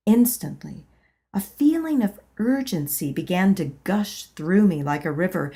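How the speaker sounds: plain reading voice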